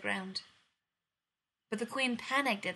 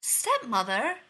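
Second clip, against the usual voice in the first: loud glad call, higher; glad cry; 3 semitones higher, 7 dB louder, slower than usual. Acted. Princess greeting her stepmother, loud glad cry. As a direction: cry out her name in joy. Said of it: "the princess cried".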